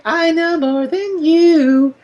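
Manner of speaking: high, slow smug sing-song. Retold or imitated. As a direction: lord it over them, slowly. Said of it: "so smug".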